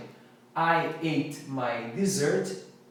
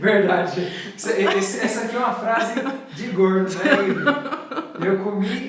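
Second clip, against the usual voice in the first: louder, laughing while teasing; 4 semitones higher, 6 dB louder than usual. Real, not acted. Host teases viewers Thalita and Rive, laughing.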